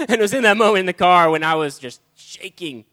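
loud, laughing while telling; laughing through words. Real, not acted. Preacher recalling being shaken in the car, laughing. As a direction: laugh as you remember it.

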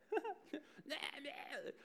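short high burst of laughter. Real, not acted. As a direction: laugh up high at your own joke. Laughing at his own joke.

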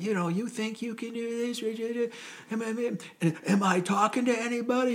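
high-pitched mock drill yell; mock scream. Drill instructor screaming at recruits, high mock yell. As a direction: scream at the recruits, but as an imitation. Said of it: "he's just screaming his guts out".

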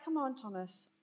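soft, gentle nudge to a doubting friend; Jesus gently urging Thomas to believe, softly; gentle coaxing. Pretend. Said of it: "it's gentle".

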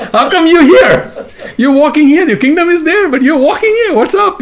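high, laughing questioning; amused, incredulous ribbing of a friend; teasing astonishment. Retold or imitated. Acting Krishna's teasing questions.

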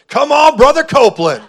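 high, loud retold exclamation; excited cheer. Retelling his excited cheer to preacher.

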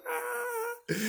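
high mock shriek of a startled coworker; imitated scream. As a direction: replay her scream. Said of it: "screams and goes".

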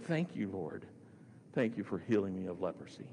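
low, subdued prayer of gratitude; humble thanks. Retold or imitated. Healed leper face down at Jesus' feet, humble thanks. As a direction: imitate someone thanking God from the ground. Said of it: "gave a prostrate expression".

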